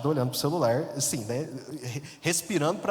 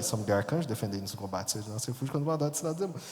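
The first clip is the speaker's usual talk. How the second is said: praying fast without looking up; hurried muttered prayer; 4 semitones lower, 4 dB quieter, faster than usual. Retold or imitated. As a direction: rattle off the prayer fast and low under your breath. Reza baixinho durante o assalto. (oração murmurada às pressas)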